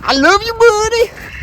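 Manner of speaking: high, rising call to a pet; high-voiced affectionate calling; high calling coo. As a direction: pitch it up high on "buddy". Calls after the escaping bird.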